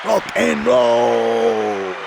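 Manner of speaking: loud, high, hugely stretched out; rock shout. Pretend. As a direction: belt it like a rock star.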